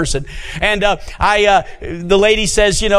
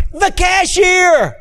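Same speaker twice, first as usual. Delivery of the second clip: delighted surprise; 11 semitones higher, much slower than usual. Retold or imitated. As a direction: stretch it out in happy recognition. Pastor realizes who she is.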